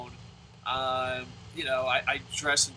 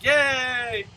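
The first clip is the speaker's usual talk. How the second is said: mock cheer; 5 semitones higher, 5 dB louder than usual. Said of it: "you don't go up and be like".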